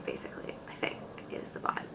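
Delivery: breathy whispered aside. Real, not acted. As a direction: drop to a whisper.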